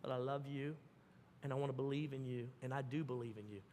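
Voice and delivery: put-on soft, low, loving words to a child; tender parent. Shown as a demonstration. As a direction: speak gently as a loving father.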